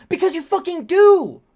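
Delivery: high, loud outburst of disbelief; furious shouted retort; exasperated shout. Real, not acted. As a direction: yell it at him, fed up. Yelling back at the creep.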